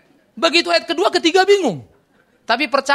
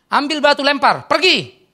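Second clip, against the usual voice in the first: loud, raised-pitch order from a tough dad; retold fatherly command; 6 semitones higher, 7 dB louder, slower than usual. Father sends his crying son back to fight, commanding. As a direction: bark the order at your son, high and loud.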